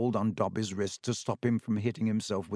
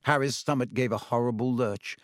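usual speaking voice

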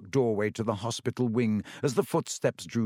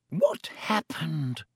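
slow breathless whisper; 5 semitones higher, much slower than usual. A narrator's reading. Pomfrey asking Dumbledore at the bedside, whispered. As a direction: breathe the question without voice. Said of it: "Madame Pomfrey whispered to Dumbledore".

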